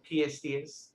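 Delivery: Rhode Island "Pierced ears" with R's dropped; Rhode Island accent. Shown as a demonstration. Repeating it for a puzzled listener, Rhode Island accent. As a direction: say "pee-est ee-iz" like a Rhode Islander. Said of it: "we don't pronounce".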